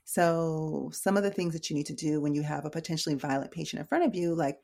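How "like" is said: normal talking voice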